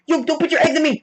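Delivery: high-pitched mock panic; freaking out. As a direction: yelp it in a playful panic. Acting out Peter freaking out.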